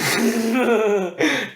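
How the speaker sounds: high, rising giggle; real amused laugh. Real, not acted. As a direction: laugh along lightly. Laughs at "going deep.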